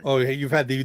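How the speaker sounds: ordinary talk, nothing marked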